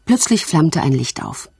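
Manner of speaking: plain reading voice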